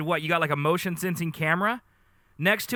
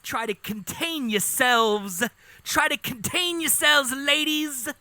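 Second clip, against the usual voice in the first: higher, mock-grand show-off bird; hammed-up vain bird, pitched up; boastful courting bird; 8 semitones higher, 3 dB louder, slower than usual. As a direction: puff up and boast to the ladies.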